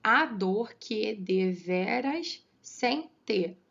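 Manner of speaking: very slow, drawn-out verse division; syllable by syllable. Shown as a demonstration. Teacher dividing the verse with students, syllable by syllable. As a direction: measure out "a dor que deveras sem ter" one syllable at a time. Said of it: "Mesmo nessa divisão".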